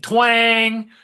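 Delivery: high, loud, drawn-out twang; bowstring twang. Retold or imitated. Arrow shot into Zeus's heart, imitated twang. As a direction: sing out the twang like a plucked string.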